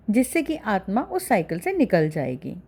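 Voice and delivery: ordinary delivery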